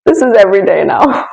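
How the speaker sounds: high, lilting la la la, unbothered; carefree sing-song. Retold or imitated. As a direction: playfully hum through the chaos. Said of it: "you're calm".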